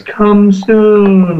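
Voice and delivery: high, drawn-out ghostly call; eerie female spirit voice, words held long; creepy, really long. Retold or imitated. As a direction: stretch SOON out long and high. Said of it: "like that, really long".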